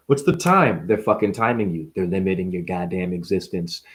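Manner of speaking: usual speaking voice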